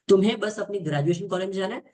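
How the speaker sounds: ordinary talk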